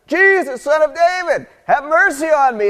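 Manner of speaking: high, loud pleading cry; a blind beggar shouting for mercy; desperate yelling. Acted. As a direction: call out over the crowd, begging. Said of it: "this guy keeps yelling".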